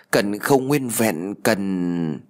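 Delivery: creepy ghost whisper; eerie ghost voice. Acted. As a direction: drag it out like a hungry ghost. Dead friend's ghost demands entrails.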